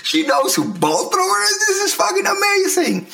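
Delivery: very high-pitched, re-enacted disbelief and delight; amazed outburst. Husband relives learning his wife knows Bolt Thrower, amazed outburst. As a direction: squeal it as if reliving a thrilling discovery.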